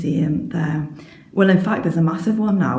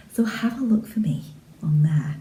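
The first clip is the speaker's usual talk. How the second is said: whispering. Real, not acted. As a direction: whisper it like a secret to the viewers. Whispered sign-off request.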